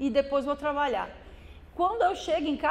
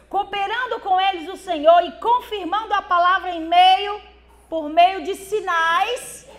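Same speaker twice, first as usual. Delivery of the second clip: sudden loud burst on the words; preaching boom; 4 semitones higher, 8 dB louder than usual. Real, not acted. Pastor reading Mark aloud to church, loud burst.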